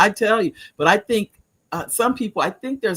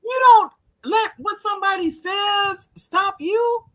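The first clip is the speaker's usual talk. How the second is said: higher, slow, emphatic exhortation; firm call-out; 12 semitones higher than usual. Retold or imitated. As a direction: preach it to her. Grandmother lecturing granddaughter firmly.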